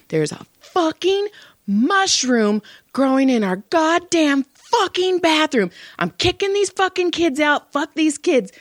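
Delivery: high, ranting fury; furious husband. Retold or imitated. Husband on the phone furious about a bathroom mushroom, high rant. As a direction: rant at the kids, fed up. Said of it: "And he's all".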